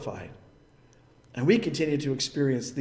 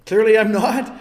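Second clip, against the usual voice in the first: wry denial; 4 semitones higher, 9 dB louder than usual. Retold or imitated.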